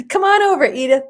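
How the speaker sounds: high, loud, laughing welcome; playful invitation. Real, not acted. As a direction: call out merrily, fresh from a laugh.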